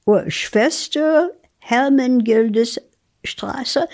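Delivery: slow, unsure reading of Schwester Hermengildes Straße; halting German name. Real, not acted. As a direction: sound it out like sight reading. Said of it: "you had a little more difficulty the second time".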